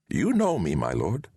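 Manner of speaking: a lightly voiced old wizard, gently correcting; a hint of a wise old sorcerer. A narrator's reading. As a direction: Deliamber speaks to Valentine in the dream. Wizard reveals himself.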